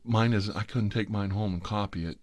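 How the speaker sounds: ordinary delivery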